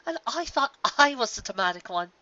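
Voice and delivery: high giggle into teasing line; giggling Rarity. Acted. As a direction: tease a friend with relief. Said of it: "Rarity giggled".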